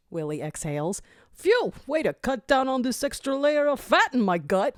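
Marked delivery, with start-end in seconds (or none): 1.3-4.8 high, winded character voice; puffing walrus voice. Acted. Willie exhales after the run, puffing and high. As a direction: blow out a breath and joke.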